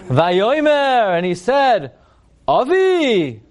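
high, grandly drawn-out biblical dialogue; stilted theatrical declamation. Acted. Rabbi mimicking Isaac calling to his father, stilted and theatrical. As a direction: declaim it like solemn old-time drama. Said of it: "who in the world talks like this".

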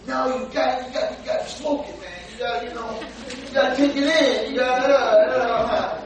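mock pushy pleading that breaks into laughs; smoker urging. Man urging the rabbi to smoke it, mock insistent. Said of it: "he was trying to tell me".

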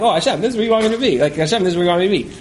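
fast, rushed, going through the motions; a quick empty recital, lightly imitated; rattled off by rote. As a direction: say it fast and mechanically, not meaning it. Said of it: "it was literally me just saying it".

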